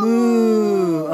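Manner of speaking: loud drawn-out moo; mooing like a cow. Shown as a demonstration. Parent showing a toddler the cow sound, loud moo. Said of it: "What's the cow say?".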